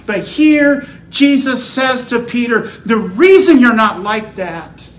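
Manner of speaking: loud, high, ringing sermon delivery; full-force preaching. Real, not acted. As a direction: proclaim the text forcefully, punching key words.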